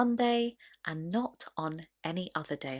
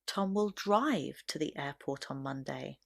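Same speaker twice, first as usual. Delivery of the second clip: strong emphasis on drive, then fall away; stress on DRIVE; 3 semitones lower, 3 dB quieter than usual. Shown as a demonstration. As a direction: hit the word drive. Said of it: "The stress here is very clearly on the word drive".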